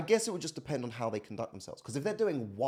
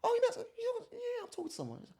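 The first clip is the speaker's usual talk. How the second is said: imitating mum; faster than usual. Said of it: "Anytime I say to her".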